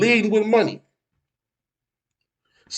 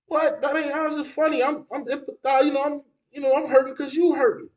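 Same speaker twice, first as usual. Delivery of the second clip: high-pitched retold protest; lightly acted kid, baffled by mom; bewildered hurt child; 8 semitones higher than usual. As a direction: plead your case like a puzzled child. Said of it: "I'm looking at her like".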